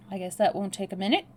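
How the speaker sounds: ordinary delivery, nothing marked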